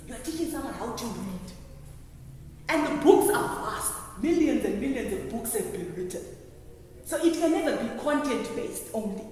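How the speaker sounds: ordinary talk, nothing marked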